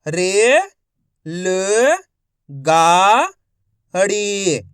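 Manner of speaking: each syllable called out loud and separate. Shown as a demonstration. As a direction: sound out every syllable slowly with a pause. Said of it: "एक एक अक्षर का उच्चारण करके".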